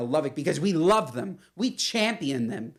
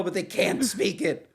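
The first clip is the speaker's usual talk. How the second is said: ordinary delivery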